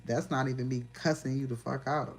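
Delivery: ordinary delivery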